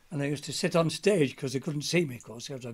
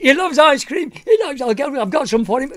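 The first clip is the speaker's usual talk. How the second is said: excited panto shout; 12 semitones higher, 16 dB louder than usual. Retold or imitated. Excited panto partner calling.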